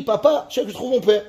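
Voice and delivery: usual speaking voice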